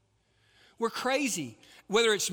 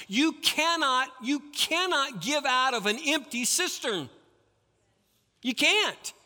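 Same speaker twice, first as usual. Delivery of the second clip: higher, pressed declaration; emphatic insistence; 6 semitones higher than usual. Real, not acted. Preacher warning parents, emphatic and raised. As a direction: drive it home hard.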